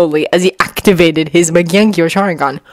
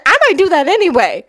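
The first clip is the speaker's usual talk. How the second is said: put-on high-pitched girl, mildly threatening; threatening Sakura; 10 semitones higher than usual. A narrator's reading. Sakura keeps threatening the boys, high voice. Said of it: "Sakura threatened".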